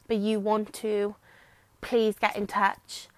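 usual speaking voice

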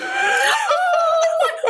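high teary laugh; overwhelmed laughing after praise. Real, not acted. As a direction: laugh off the emotion.

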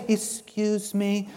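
soft, slow, meek asking; mock timid politeness, barely pushing; a meek voice that does not press, the opposite of the woman's grab; overly polite request. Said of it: "She didn't say".

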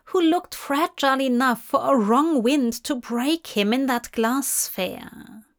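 usual speaking voice

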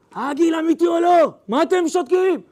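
sudden furious shout after the calm. Retold or imitated. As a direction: yell the question at the stunned crowd. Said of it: "אז אני צועק, צורח".

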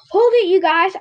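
high, loud command; yelling. A narrator's reading. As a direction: yell to halt runaway dogs. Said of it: "I yelled to the dogs".

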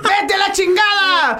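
high, slow shouted mock curse; screamed curse. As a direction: drag out the yell like a furious lady. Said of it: "empiezan a gritar maldiciones".

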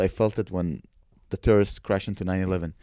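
usual speaking voice

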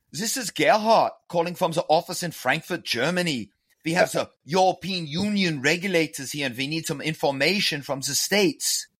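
German accent put on to fool a caller; fake German accent. Shown as a demonstration. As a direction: play a German compliance chief calling from Frankfurt. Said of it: "using this accent".

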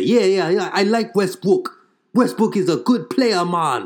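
high Jamaican-lilted praise; friendly vendor agreeing warmly. Retold or imitated.

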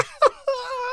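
shrieking laugh, loud and high; high burst of laughter. Real, not acted.

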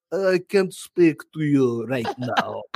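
slow, labored mock-Russian English; fake Russian accent. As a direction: deliver it slowly in a bad Russian accent. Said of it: "with my fake ass".

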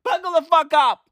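hyped warning. Retold or imitated. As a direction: pump everyone up to brace. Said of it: "They're like".

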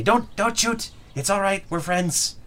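high urgent little kobold; a high, pleading call to stop. Acted. Kobold guide calling out to the charging kobolds, high and urgent. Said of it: "you can all hear him clearly say".

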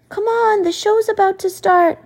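put-on high, loud child's call. A narrator's reading. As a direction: hurry the family to the TV. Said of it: "Abigail calls".

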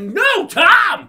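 high, loud acted correction; indignant shout. Correcting her about his own name, indignant shout. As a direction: yell the name as a comic punchline.

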